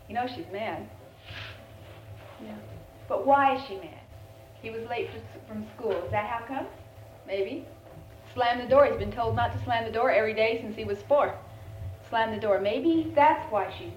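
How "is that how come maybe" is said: ordinary delivery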